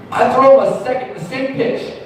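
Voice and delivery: ordinary delivery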